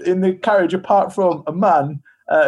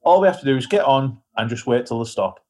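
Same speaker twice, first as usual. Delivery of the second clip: normal talking voice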